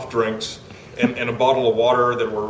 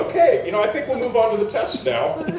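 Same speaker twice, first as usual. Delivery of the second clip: awkward deflection; 8 semitones higher than usual. Retold or imitated. Host steering psychic on to the test, high-pitched deflection. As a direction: retell a polite brush-off, voice pitched way up.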